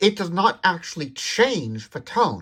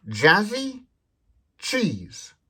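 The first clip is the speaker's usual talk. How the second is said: playful command demo; 6 semitones higher, much slower than usual. Shown as a demonstration. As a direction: say a silly phrase to the phone. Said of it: "you could say something like".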